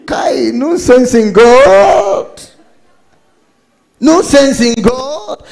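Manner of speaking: high, drawn-out preacher's cry; loud exclamation. Real, not acted. Preacher exclaiming about God to congregation, drawn-out cry.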